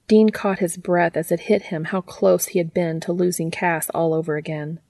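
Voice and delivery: usual speaking voice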